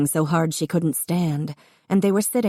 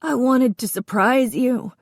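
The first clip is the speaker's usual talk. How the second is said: tearful; 7 semitones higher than usual. A narrator's reading. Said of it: "tears pouring down his face".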